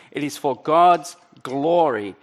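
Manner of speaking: usual speaking voice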